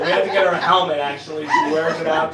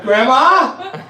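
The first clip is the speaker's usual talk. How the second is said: loud, higher worried call; 6 semitones higher, 6 dB louder than usual. Retold or imitated. Grandson calling to fallen grandma, loud high call. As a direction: call down to her.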